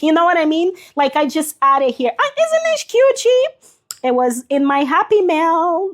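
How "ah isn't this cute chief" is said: high, gushing delight over a cute thing; gushing high-pitched delight at a cute gift; cute squeal. Real, not acted. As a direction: squeal it like you just opened a present. Showing a cute gift from mail.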